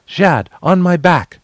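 high, loud, slow order; urgent command. Acted. Ordering Jad onto her back, a high loud order. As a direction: call him onto your back at once. Escaping over the side.

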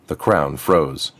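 plain reading voice

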